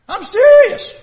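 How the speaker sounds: sudden high, loud cry of insistence; God raising his voice at Adam; emphatic outburst. Acted. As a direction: burst out so he can't ignore you.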